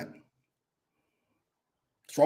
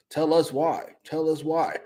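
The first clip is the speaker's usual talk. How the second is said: ordinary talk, nothing marked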